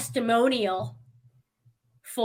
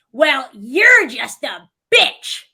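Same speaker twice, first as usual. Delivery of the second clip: loud, slow, drawn-out insult; retold insult; 2 semitones higher, 9 dB louder, much slower than usual. Stranger turns around on the speaker, insulting. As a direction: imitate her spat-back insult, hitting the last word hard. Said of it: "turns around and she goes".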